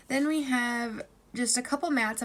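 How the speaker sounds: usual speaking voice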